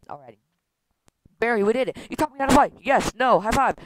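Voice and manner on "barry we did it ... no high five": raised, loud cheering; elated celebration. Acted. Vanessa and Barry after landing the plane, elated. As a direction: cheer the success with a higher voice.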